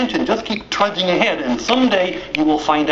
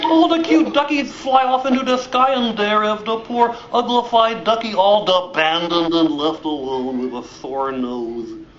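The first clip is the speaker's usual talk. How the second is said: usual speaking voice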